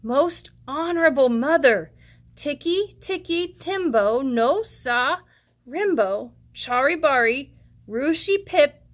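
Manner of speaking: put-on slow, careful little boy's voice; deliberate, unhurried child; very slowly. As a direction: spell out each piece of the name slowly. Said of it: "very slowly said".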